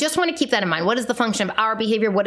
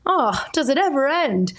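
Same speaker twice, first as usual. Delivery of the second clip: exasperated; 6 semitones higher than usual. Real, not acted. Exasperated at constant learning.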